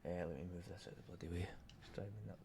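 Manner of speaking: fast, barely voiced grumble to himself; whispered aside. Real, not acted. Trader grumbling to himself at the cluttered screen, whispered aside. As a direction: mutter it under your breath, irritated.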